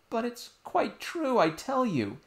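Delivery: put-on high, slow pleading child voice; a small girl insisting she's telling the truth; earnest little princess. Princess insisting to her nurse, high and earnest.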